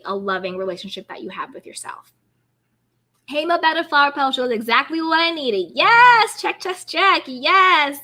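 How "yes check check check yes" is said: excited yes. Real, not acted. Cheering a super chat.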